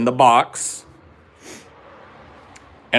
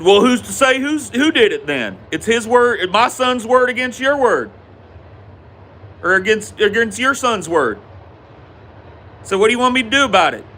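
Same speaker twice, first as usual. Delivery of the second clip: high-pitched argumentative dad voice; escalating confrontation; 10 semitones higher than usual. Retold or imitated. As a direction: imitate a father challenging another over his son. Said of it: "it started to elevate, escalate".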